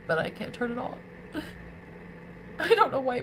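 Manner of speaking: real tearful confession, voice breaking with crying. Real, not acted. Confessing she cannot stop loving him, crying. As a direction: cry through the words helplessly.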